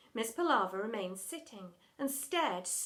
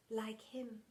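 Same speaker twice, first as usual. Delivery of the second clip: unvoiced whisper; whispered aside; 8 dB quieter than usual. Drama teacher whispering an aside about headmistress. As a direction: whisper it behind your hand.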